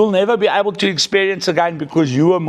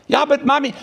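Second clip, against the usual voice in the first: put-on high pleading childlike voice; imitated little girl; 10 semitones higher than usual. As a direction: beg Mommy for attention like a toddler. Child pleading with her mother.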